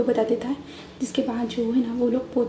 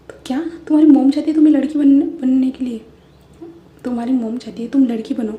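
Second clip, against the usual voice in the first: shocked disbelief; 10 dB louder than usual. Acted. Shocked friend questions Wei's mom.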